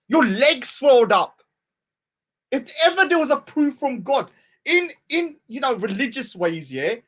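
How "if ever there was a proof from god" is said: normal talking voice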